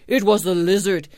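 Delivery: a hint of owl as you accuse; owl passing blame. A narrator's reading. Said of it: "said the owl".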